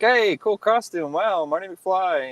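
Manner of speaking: high, drawn-out admiring call; wowed onlooker, pitched well up; impressed partygoers. Retold or imitated. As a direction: imitate fans cheering a costume, stretching the words. Voicing impressed partygoers' compliments.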